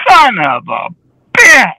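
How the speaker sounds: tagline exclamation. Retold or imitated. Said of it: "his tagline is".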